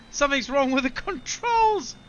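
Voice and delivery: loud, high panic; alarmed and rushed; panicked. Real, not acted. Plane goes haywire.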